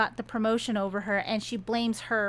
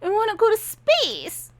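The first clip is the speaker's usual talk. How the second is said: upset Leticia; 6 semitones higher than usual. Retold or imitated. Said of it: "Leticia's like".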